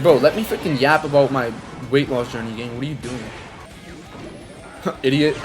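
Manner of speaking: usual speaking voice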